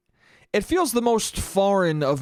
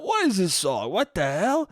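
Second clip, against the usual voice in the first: rising, baffled, higher and slower at the end; lightly voiced bewildered Italian dad; mock confused dad; 5 semitones higher than usual. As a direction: play a puzzled old man hearing the song. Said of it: "the ones who are like".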